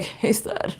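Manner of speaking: hammed-up pickup voice; mock pickup line. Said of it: "It doesn't have to be like".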